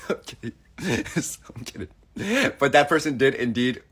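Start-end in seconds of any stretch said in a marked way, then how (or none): 0.1-1.9 laughing whisper. Real, not acted.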